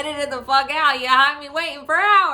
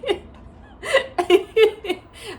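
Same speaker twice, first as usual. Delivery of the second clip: crying laughter; 8 semitones higher, 5 dB louder than usual. Real, not acted. Laughing over negative test.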